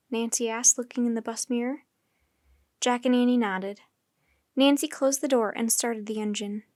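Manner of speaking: ordinary delivery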